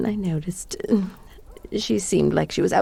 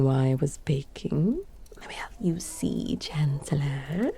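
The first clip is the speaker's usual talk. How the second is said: secretive villain whisper; conspiratorial whisper; 5 semitones lower, 7 dB quieter than usual. Acted. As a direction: lean in and whisper the plot. Confiding evil plot.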